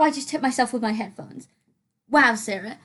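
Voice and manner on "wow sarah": ordinary talk, nothing marked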